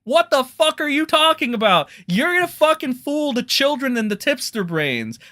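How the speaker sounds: furious outburst; angry rant. Real, not acted. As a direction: tear into them furiously.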